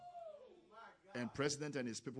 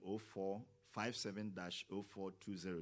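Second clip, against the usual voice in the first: ordinary delivery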